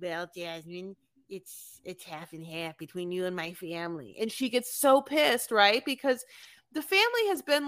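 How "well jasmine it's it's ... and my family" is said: much softer, lower mumble; husband meekly answering his wife; mimicking Gino. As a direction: say it quietly and meekly. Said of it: "he's like".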